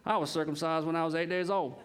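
put-on Southern twang, playfully boastful; hammed-up Southern accent. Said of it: "Southern accent".

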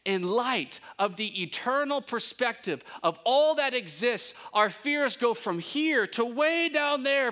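high, loud climactic preaching; preaching at full force. Real, not acted. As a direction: drive it home to the congregation. Sermon climax.

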